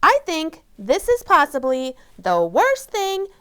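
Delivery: Amy shouting about the playdate disaster. Acted. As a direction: cry out the disaster at full volume. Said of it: "shouted Amy".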